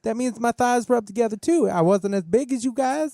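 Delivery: high lisping Mike Tyson impression; squeaky, lispy boxer voice. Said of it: "I don't really sound like him".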